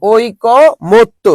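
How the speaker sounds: ঐকমত্য very slowly as oi-ko, mot-to; syllable-by-syllable pronunciation. Shown as a demonstration. Teacher assembling the sounds for students, ঐকমত্য slowly in syllables. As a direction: pause between the parts of ঐকমত্য, oi... ko... mot... to. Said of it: "এটা হবে ওই".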